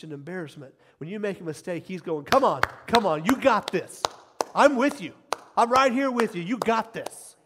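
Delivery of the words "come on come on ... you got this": loud, raised rallying shouts; cheering father. Acted. Heavenly Father to a child who erred, cheering loudly. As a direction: urge them on with forceful encouragement. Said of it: "He's cheering you on".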